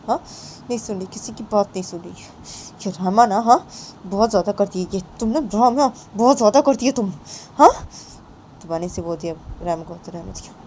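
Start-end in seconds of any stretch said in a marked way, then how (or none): none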